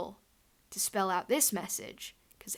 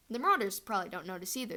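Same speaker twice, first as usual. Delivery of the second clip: ordinary delivery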